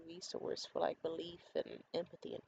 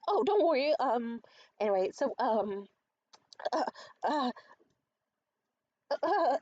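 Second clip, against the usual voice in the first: high, loud flustered stammer with long gaps; imitating flustered man; 6 semitones higher, 7 dB louder than usual. Date dodging a question about his phone call, mock flustered. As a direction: mimic a rattled man brushing off concern. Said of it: "he starts just getting really flustered".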